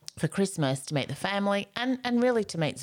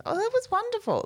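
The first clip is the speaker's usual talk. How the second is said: much higher, sarcastic gush. Shown as a demonstration. Showing how the right tone makes it sarcastic, a high gush. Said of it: "if you say it with the right tone".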